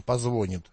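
"позвонит" stressed on the middle syllable, позвОнит; colloquial stress shift. Shown as a demonstration. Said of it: "примут тоже это как норму".

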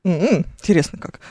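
ordinary delivery

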